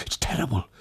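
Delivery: high, hushed fearful voice; frightened, half under the breath; terrified. Acted.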